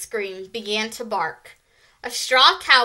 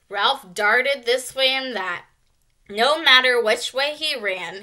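plain reading voice

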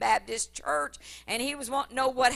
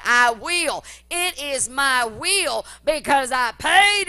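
full-force preaching; 2 semitones higher, 7 dB louder than usual. Acted. Preacher rousing the congregation about healing, loud fervent preaching. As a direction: preach it out to the whole church.

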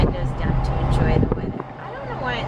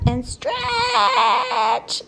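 high bright cry of delight; much higher squeal at a stretching pup; excited cry. Real, not acted. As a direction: cheer the dog's big stretch. Cheering the dog's stretch.

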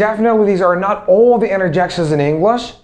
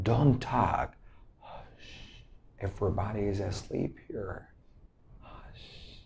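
very soft, near-whispered hushing; quiet them so sleepers don't wake; low, barely audible warning; 8 semitones lower, 16 dB quieter than usual. Acted. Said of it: "make someone quiet".

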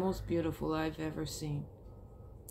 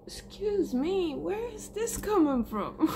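surprised aside; 11 semitones higher than usual. Real, not acted. Caught off guard by own emotion.

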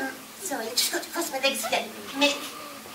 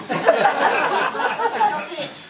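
ordinary talk

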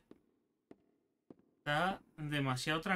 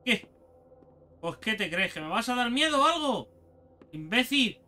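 loud mocking jeer; taunting shout; 5 semitones higher, 3 dB louder than usual. Real, not acted. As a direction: yell a taunt at the monster. Taunting the monster.

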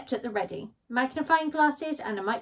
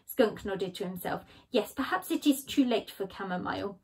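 ordinary delivery, nothing marked

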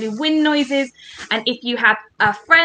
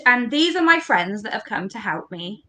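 normal talking voice

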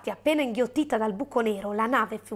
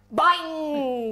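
long drawn-out boing; booming "boing"; 3 semitones higher than usual. A narrator's reading. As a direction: stretch out the boing like a big bang. Acts out the sudden bang.

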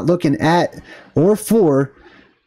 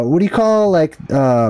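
usual speaking voice

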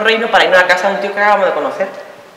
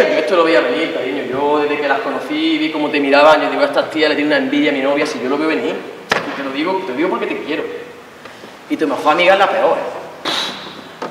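put-on lower, soft, wheedling boyfriend; jealous boyfriend voice; 6 semitones lower, 4 dB quieter than usual. Boyfriend talks girlfriend out of dancing, in a low coaxing voice. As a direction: coax her like a possessive boyfriend.

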